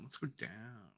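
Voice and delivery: normal talking voice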